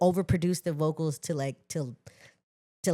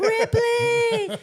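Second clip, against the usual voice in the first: much higher, louder laughing shout; laughing call-out; 7 dB louder than usual. Retold or imitated. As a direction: call his name out with glee. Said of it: "I'm like".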